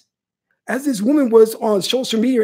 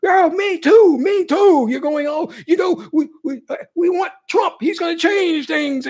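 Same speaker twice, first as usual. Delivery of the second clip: mocking naive supporter; 7 semitones higher than usual.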